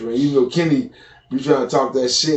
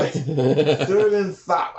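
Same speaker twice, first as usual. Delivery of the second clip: slow, thick-tongued name; big tongue voice; much slower than usual. Retold or imitated. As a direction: mumble it through a big tongue. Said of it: "the one with the big tongue".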